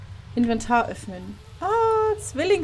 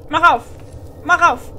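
"Mach auf" louder and higher, pressing hard; impatient demand; 4 semitones higher, 5 dB louder than usual. Real, not acted. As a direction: bark "Mach auf" at the stuck door.

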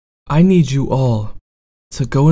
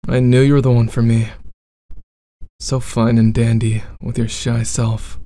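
bad boy roleplay.

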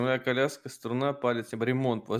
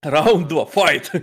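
fighting game announcer; 7 dB louder, much slower than usual. Pretend. Announcer call.

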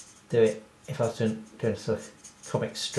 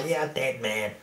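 gloating mock-villain cackle around a threat; playful evil cat voice; attacking cartoon cat; 3 semitones higher, 5 dB louder, slower than usual. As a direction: cackle and taunt as the cat closing in. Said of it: "Like that".

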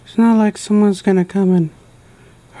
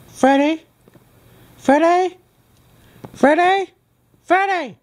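rising calls of his name, each higher; calling out; 7 semitones higher than usual. Acted. Mangle calling for Freddy, each call higher.

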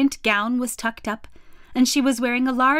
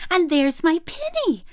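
put-on higher, eager little-girl exclamation; excited cry; 7 semitones higher than usual. A narrator's reading. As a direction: exclaim on spotting your lost things. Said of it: "cried Lucy".

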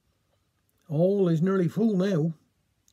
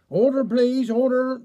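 loud, high call of order; 5 semitones higher, 4 dB louder than usual. Acted. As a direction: rap the room back to order. Chairman calls hall to order.

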